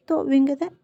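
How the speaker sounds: ordinary delivery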